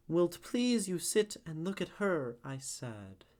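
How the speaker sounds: slow, measured poetry recital; poem reading. Acted. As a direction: read it slowly, feeling the iambic beat. Reads Browning poem aloud.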